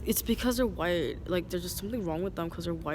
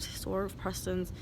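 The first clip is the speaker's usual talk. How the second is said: ordinary talk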